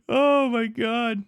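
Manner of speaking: high, slow "oh my God" after a burst of laughter; breathless laughing disbelief. Real, not acted. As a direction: laugh until the words stretch out. Cracking up at proof of life.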